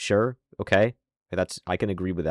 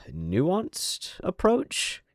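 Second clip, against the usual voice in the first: high, very slow, mocking stretch of the words; mock-polite scorn; sarcastic drawl; 11 semitones higher, much slower than usual. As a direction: drag it out sneeringly, as if the word is a joke. Sarcastic pause before the word.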